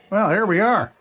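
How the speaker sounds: normal talking voice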